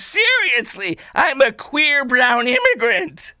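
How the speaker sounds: high, slow mock-earnest declaration; incredulous parody of a serious claim; mocking imitation. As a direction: overplay the solemn announcement. Mimics man said to be serious.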